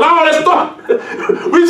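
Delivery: laughing through the punchline, voice jumping up; laughing at the joke. Real, not acted. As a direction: laugh it out high. Preacher laughing at own punchline.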